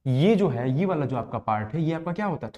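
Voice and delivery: ordinary talk, nothing marked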